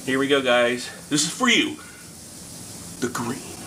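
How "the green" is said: hushed dramatic whisper. Acted. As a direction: whisper it like a big secret. Dramatic title announcement.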